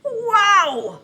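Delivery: high, loud wow. Shown as a demonstration. Teacher reacting loudly after yelling. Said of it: "that was loud".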